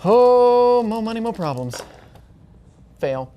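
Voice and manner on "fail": ordinary talk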